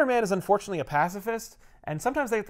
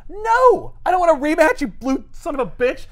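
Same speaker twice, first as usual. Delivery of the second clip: much higher, louder enraged yell; furious Challenger; 11 semitones higher, 7 dB louder than usual. Retold or imitated. Challenger refusing the rematch, furious yell. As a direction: scream the refusal in rage.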